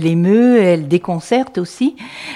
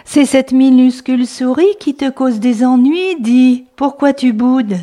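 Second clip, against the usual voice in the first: higher, louder read-aloud refrain; storytelling refrain; 5 semitones higher, 4 dB louder than usual. Acted. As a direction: call out the refrain to kids. Refrain repeated.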